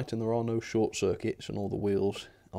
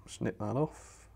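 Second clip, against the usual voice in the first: ordinary talk, nothing marked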